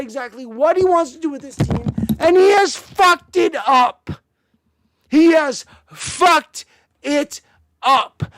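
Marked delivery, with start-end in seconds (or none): 2.2-8.2 higher, louder, slowed-down blame; slow furious accusation. Real, not acted. Fan blaming the head coach, slow and furious. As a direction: spell out the blame slowly. Angry rant about coach.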